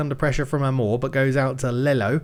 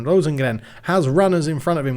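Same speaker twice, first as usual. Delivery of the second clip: usual speaking voice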